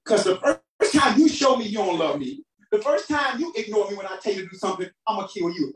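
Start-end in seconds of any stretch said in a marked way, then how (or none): none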